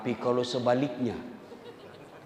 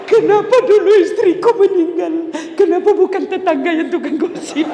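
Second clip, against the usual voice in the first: high, loud hammed-up wail of a grieving husband; mock weeping husband; 11 dB louder than usual. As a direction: wail it like a crying widower, half joking. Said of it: "lalu suamimu menangis". (suami pura-pura meratap dengan suara tinggi)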